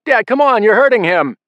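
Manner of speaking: high, loud frantic plea; yelling. A narrator's reading. Brothers yell at father to let go, frantic shout. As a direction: yell at him to stop. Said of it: "yelling at him to let go".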